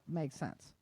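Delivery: ordinary talk, nothing marked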